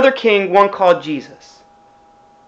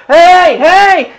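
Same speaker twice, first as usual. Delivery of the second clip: loud, high calling-out; yelling; 8 semitones higher, 6 dB louder than usual. Acted. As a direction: yell to someone wearing earplugs. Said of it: "you're yelling".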